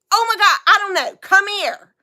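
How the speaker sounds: high, loud, quick bickering wife; mock exasperation. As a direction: act fed up with his fussing.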